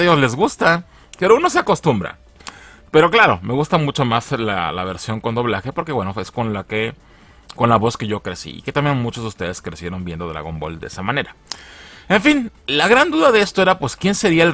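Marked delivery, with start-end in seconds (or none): none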